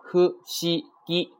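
hu, shi, gi as three separate sounds, held high; kana by kana. Shown as a demonstration. Teacher lists three kana, hu, shi, gi apart. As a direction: space out hu, shi, gi one at a time. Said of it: "它是由三个假名啊".

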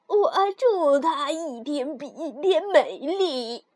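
slow, quavering dwarf voice; dwarf granting a wish. Acted. First dwarf blesses the kind girl, slow dwarf voice. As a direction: pronounce the blessing slowly, as a dwarf.

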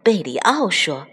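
usual speaking voice

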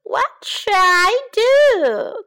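very high, loud and slow dismay; anxious mouse; distressed cry. A narrator's reading. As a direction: cry out in despair. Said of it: "cried the mouse".

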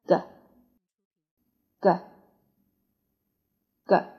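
咯 as a short voiced g burst from the back of the tongue; phonics g sound. Shown as a demonstration. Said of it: "声音从这个地方冲出来".

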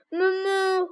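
long, level moo; cow mooing. Pretend. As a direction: imitate a cow's drawn-out moo. Imitating a cow in animal quiz.

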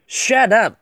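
loud, high-pitched yell; forceful demo shout, falling at the end. Shown as a demonstration. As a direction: shout it as one sharp burst. Final emphatic rendition of the phrase.

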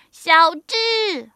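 high, loud scolding call; sharp motherly shout; old woman shouting. A narrator's reading. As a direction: yell the name at a naughty son. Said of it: "老妇人喊道".

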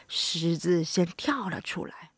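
plain reading voice

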